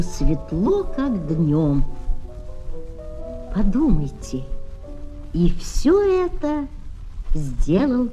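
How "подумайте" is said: ordinary delivery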